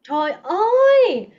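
high, loud, drawn-out cry of amazement; delighted exclamation. Real, not acted. Teacher praising a student's pronunciation, delighted.

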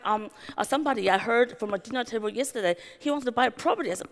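ordinary delivery

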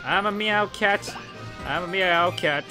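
ordinary talk

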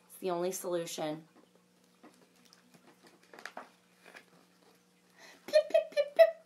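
lively storybook read-aloud with big reactions; reading to children. A narrator's reading. Librarian reading a unicorn story to children, animated. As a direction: read it like a picture book for kids.

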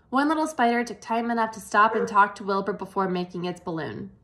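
ordinary delivery

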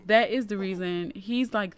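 ordinary talk

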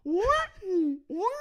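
ordinary delivery

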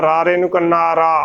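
ordinary talk

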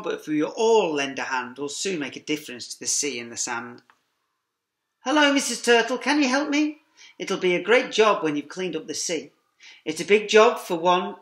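ordinary delivery, nothing marked

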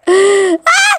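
short, high cry of excitement; excited squeal. Real, not acted. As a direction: gasp then high little squeal. Thrilled about upcoming season two.

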